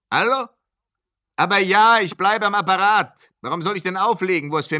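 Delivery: high and loud over a crackling line; shouting into the phone. Acted. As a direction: make yourself heard on a bad phone line. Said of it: "Ich schreie laut?".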